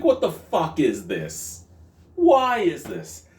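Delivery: high, loud, slow; exasperated outburst. Real, not acted. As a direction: throw the question up in disbelief. Ranting about a sour stout.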